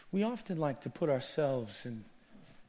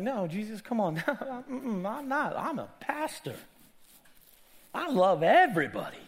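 retold protest; 8 semitones higher, 5 dB louder than usual. Pastor denying his fault to Jesus, high-voiced protest. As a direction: protest in a high, lighter voice with a little laugh.

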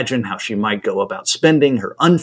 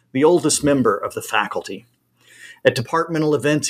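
ordinary delivery, nothing marked

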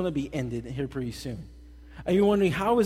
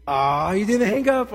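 loud, drawn-out teasing "Ah!"; hammed-up lovey-dovey glee; mock delight; 3 semitones higher, 6 dB louder than usual. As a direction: playfully catch your sweetheart out. Mock delighted catching sweetheart out.

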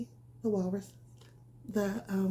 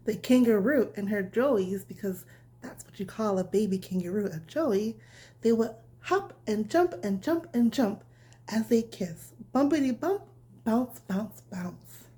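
bedtime story reading; 6 dB louder than usual. A narrator's reading. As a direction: read it gently like tucking a child in. Reads bedtime story to children.

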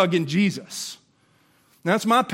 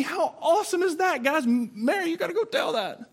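excited; 13 semitones higher than usual. Real, not acted.

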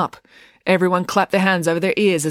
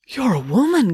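higher, startled exclamation; gasp of surprise; 6 semitones higher, 4 dB louder than usual. A narrator's reading. Jo to the woman parachutist, gasping in surprise. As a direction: blurt it out in astonishment. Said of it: "gasped Jo".

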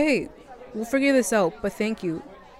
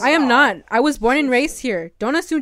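loud, hurt and angry; angry denial; 3 semitones higher, 7 dB louder than usual. Acted. Girl snaps back at classmate's insult, angry and loud. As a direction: fire back at the bully.

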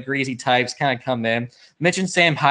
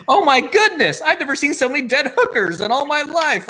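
very high, hammy radio-comedy astonishment; old-timey radio bit; 13 semitones higher than usual. Retold or imitated. Boss finding dead hookers, old-timey radio mock shock. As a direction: exclaim it high in broad radio rhythms. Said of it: "an old-timey radio bit".

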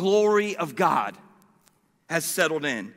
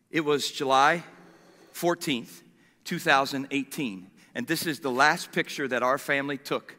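normal talking voice

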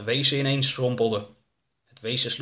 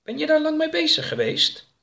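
high-pitched character voice; high, keen questioner; curious story character; 4 dB louder than usual. Acted. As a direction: ask in a much higher voice.

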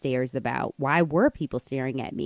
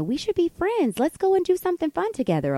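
high, gushing mock friendliness; overfriendly stranger; 12 semitones higher than usual. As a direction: imitate someone eagerly making instant friends.

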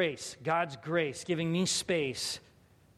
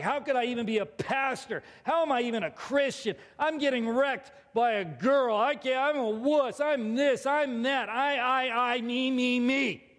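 higher-pitched, building self-berating outburst; self-angry rant; 5 semitones higher than usual. Retold or imitated. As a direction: beat yourself up in a rising tirade. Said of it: "I'm mad at myself, and I feel guilty and ashamed".

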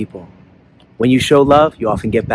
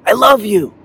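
echoed yell; 7 semitones higher, 3 dB louder than usual. A narrator's reading. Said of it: "he hears back".

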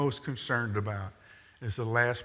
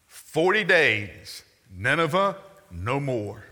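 slow, drawn-out prophet's warning; solemn slow proclamation; 4 semitones higher, 5 dB louder, slower than usual. Shown as a demonstration. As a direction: let each word fall heavily like a doom sentence. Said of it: "Here's his whole sermon".

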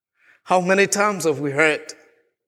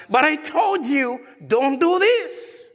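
exasperated father shout; 7 semitones higher than usual. Retold or imitated. Father repeats his warning to crying Joanna, shouting. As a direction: raise it into a frustrated shout at the child.

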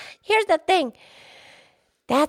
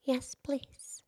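whisper; 4 semitones lower, 16 dB quieter than usual. Real, not acted.

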